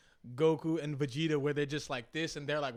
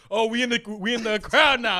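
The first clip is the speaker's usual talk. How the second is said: loud, higher, acting out the excitement; retold hyped reaction; 7 semitones higher, 11 dB louder than usual. Performer retelling a leap into the crowd, hyped. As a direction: replay the thrill, voice lifted and loud.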